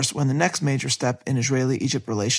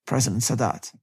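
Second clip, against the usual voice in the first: ordinary delivery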